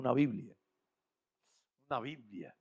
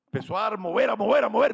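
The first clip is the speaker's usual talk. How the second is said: rising, louder and louder as he flips; frantic flipping; 6 semitones higher, 7 dB louder than usual. Retold or imitated. Preacher acting out the frantic man flipping pages. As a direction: build the panic with each repeat.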